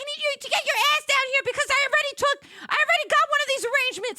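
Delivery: high, loud, very fast; frantic rush. Retold or imitated. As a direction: gabble it in a panic. Sister urging, rushing.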